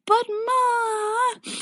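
very high, drawn-out mock wail; hammed-up crying protest; whining teenager. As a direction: whine like a grounded kid. Grounded son protests to his mother.

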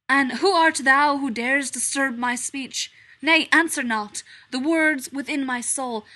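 loud theatrical verse; declaiming Hamlet. Acted. Hamlet declaiming to the stranger, loud and theatrical. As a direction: proclaim it from the stage.

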